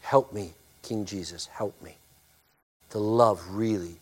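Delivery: ordinary delivery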